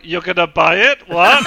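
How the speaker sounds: imitating a loud, startled merchant; mock surprise. Player imitating the merchant's surprise, loud. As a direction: echo the merchant's shock, hammed up. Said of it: "I like how [name]'s like".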